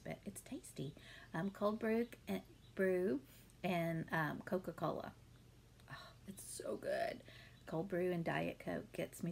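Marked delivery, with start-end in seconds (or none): 0.0-0.9 secretive whisper. Real, not acted. Confiding guilty pleasure. 5.9-7.2 blissful whisper. Real, not acted. Savoring her drink.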